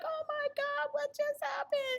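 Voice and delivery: high-pitched distressed cry; retold hysterical crying. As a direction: relive the moment in near-hysterics. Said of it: "I'm crying, I'm hysterical".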